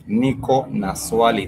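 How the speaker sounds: Niko na swali spoken slowly, syllable by syllable; slow it down so learners can follow; slow model phrase. Shown as a demonstration. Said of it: "The ni, ni is I".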